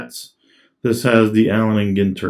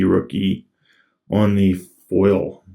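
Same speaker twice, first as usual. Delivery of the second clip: normal talking voice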